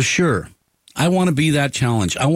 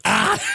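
squeaky burst of laughter; a sudden high delighted laugh; 3 dB quieter than usual. Real, not acted. Laughs at guest's remark.

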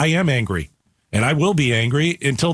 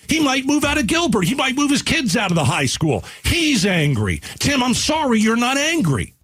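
indignant, pitched-up scolding; heated anger; 8 semitones higher than usual. Real, not acted. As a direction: lecture the caller, pitch climbing. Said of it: "I was angry".

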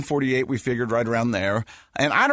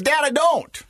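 put-on higher, louder little-boy insistence; imitating son; 14 semitones higher, 4 dB louder than usual. Son insisting to dad, higher mock child voice. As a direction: play the nine-year-old digging in. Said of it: "And he goes".

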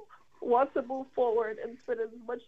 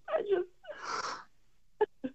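crying; 8 semitones higher than usual. Real, not acted. Daughter apologizes to father on air.

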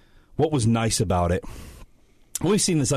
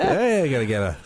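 higher, hammed-up Aussie accent; Australian accent; 5 semitones higher, faster than usual. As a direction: lay on the ocker Australian. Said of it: "Australian for beer".

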